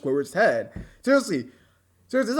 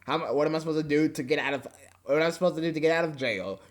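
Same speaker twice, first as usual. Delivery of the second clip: ordinary talk, nothing marked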